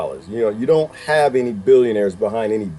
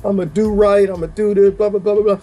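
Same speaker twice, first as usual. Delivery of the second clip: imitating grateful inmate; 6 semitones higher than usual. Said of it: "she's, like, crying".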